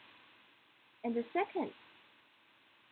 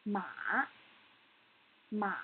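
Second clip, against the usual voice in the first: "Ma" dipping down then back up; 3 semitones lower than usual. Shown as a demonstration. Teacher demonstrates the third tone, "Ma" falling then rising. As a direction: let "Ma" sink and then lift. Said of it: "needs to go down and up again".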